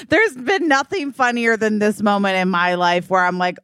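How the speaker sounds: ordinary delivery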